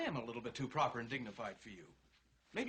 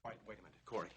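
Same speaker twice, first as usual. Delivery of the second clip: usual speaking voice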